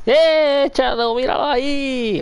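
high, excited call-out; llamado efusivo. Real, not acted. Calls out excitedly to Shadow. (llamado agudo y entusiasmado)

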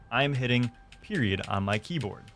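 normal talking voice

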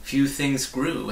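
ordinary delivery

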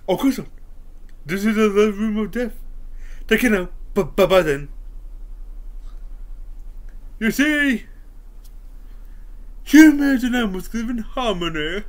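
loud high cartoonish voice; silly clown voice. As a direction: play a zany clown. Jim Carrey character voices.

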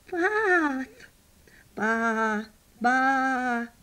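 bath stretched into baas; sheep bleat. Acted. Sheep announces bath time, bleating it out.

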